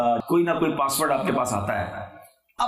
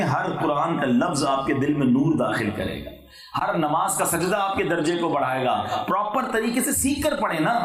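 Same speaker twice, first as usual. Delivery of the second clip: ordinary talk, nothing marked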